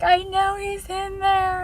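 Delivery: put-on insistent girlfriend. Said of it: "she's like".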